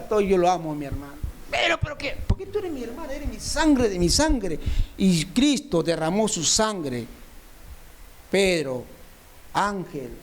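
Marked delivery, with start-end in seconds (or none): 1.5-4.6 higher, half-pleading sister's voice, not a real yell; mock the sister's protest, pitched up; imitating his sister. Preacher imitating his sister protesting her love for him, higher mock plea. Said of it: "me grita".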